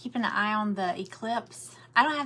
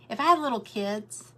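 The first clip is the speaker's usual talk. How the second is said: normal talking voice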